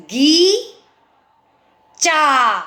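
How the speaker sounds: teacher spelling by syllables; separate syllables. Shown as a demonstration. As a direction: sound out बगीचा one syllable at a time. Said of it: "च को मैंने आ बोला है".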